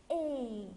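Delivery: اي held long as French é; teacher modelling اي as a long é; accented E sound. Shown as a demonstration. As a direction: stretch اي like "ay". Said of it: "بيتنطق".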